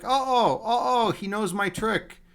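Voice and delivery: high-pitched mock alarm on the uh-ohs; voicing a worried opponent. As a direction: play the rival's dawning panic, drawn out and high. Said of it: "now he's like".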